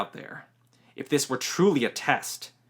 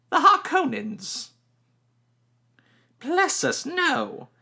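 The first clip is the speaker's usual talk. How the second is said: high, mocking old woman's outburst; old woman scoffs at the boy's guess; scornful old crone; 4 dB louder, slower than usual. A narrator's reading. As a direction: scoff at the very idea. Old woman figure voiced.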